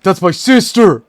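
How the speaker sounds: loud, high outraged exclamation; hammed-up shocked shout; imitating the clip. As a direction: yell it like the viral video guy. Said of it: "He says it like that".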